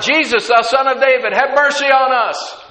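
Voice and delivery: loud, high-pitched pleading call; crying out. Acted. Blind men calling after Jesus, crying out loudly. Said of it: "two blind guys crying out".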